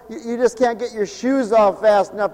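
usual speaking voice

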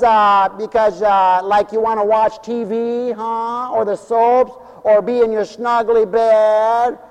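hammed-up Valley Girl voice; Valley Girl talk. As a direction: tease the congregation like a Valley Girl. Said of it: "people sound just like the valley creatures".

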